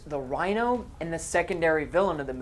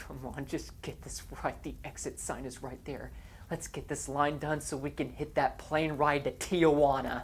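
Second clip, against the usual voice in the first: inner voice; 3 semitones lower, 8 dB quieter than usual. Retold or imitated. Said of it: "they're thinking to themselves".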